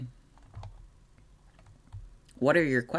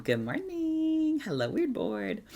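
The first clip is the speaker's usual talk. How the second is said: high, drawn-out good morning; singsong greeting; slower than usual. Real, not acted. Greeting a newcomer mid-answer.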